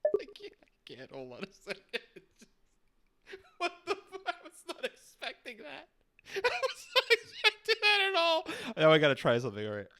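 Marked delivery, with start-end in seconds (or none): 0.1-4.2 high, soft squeal through laughter; helpless laughter. Real, not acted. Listener floored by the Escobar voice, laughing helplessly. Cracking up at the impression. 4.3-8.5 high squeaky voice swallowed by laughter; real laughing fit. Real, not acted. Listener surprised by the Escobar voice, laughing hard. Cracking up at the impression.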